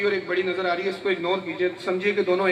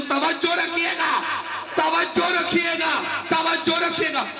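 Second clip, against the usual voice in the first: drawn-out, high, raised call to listen; 8 semitones higher, slower than usual. Real, not acted. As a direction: pull the whole hall's focus, slow and strong. Preacher calls audience to attention.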